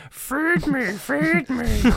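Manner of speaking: high falsetto in a man's put-on lady voice; drawn-out, squeaky plea to be fed; mock Python lady begging; Monty Python woman's voice. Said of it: "just doing a Monty Python woman's voice".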